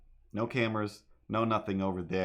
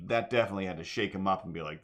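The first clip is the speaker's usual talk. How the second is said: ordinary delivery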